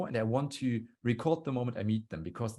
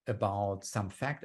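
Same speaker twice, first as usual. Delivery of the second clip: ordinary talk, nothing marked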